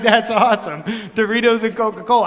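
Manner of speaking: real laugh through the words; chuckling with delight at the story. Real, not acted.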